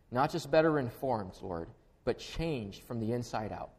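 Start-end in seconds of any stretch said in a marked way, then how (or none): none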